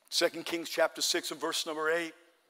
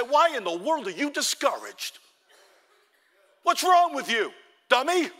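loud, higher exasperated talking-to; mock self-scolding; 9 semitones higher, 7 dB louder than usual. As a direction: tell yourself off with force. Preacher voices scolding oneself in mirror.

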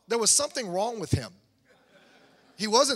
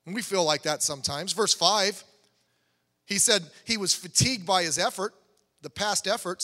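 ordinary talk, nothing marked

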